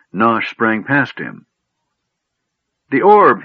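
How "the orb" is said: high, loud cry of alarm; screech. A narrator's reading. Gnome seeing the mage has the orb, screeching. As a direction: screech it out, upset. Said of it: "he screeched".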